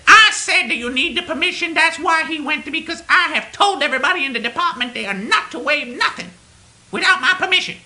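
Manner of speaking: high, loud, emphatic caricature; mocked bossy manager. Manager insisting on her permission, high loud mockery. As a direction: lay down the law, exaggerated and high. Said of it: "She said".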